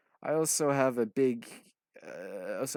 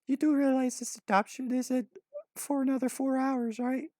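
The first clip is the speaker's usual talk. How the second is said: put-on high-pitched young girl voice, a little louder; 4 dB louder than usual. A narrator's reading. Orphan girl questioning the boys, high voice.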